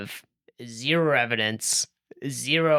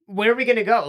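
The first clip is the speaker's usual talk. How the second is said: higher, louder, fast incredulous rant; excited exasperation; 9 semitones higher, 4 dB louder than usual. Real, not acted. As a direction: pile on the questions in disbelief.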